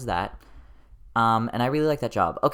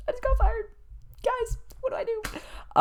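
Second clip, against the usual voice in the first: panicked squeak. Retold or imitated. Panicked text to family.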